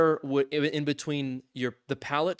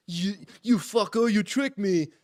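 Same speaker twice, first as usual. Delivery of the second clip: higher, slow put-on outrage; mock angry accusation; 7 semitones higher than usual. Neighbor imagined turning on the narrator, slow hammed-up outrage.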